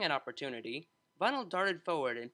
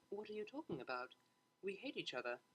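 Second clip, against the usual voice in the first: much softer, firm denial; hushed denial; 4 semitones higher, 10 dB quieter than usual. A narrator's reading. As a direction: shut the idea down quietly. Octavia denying quietly.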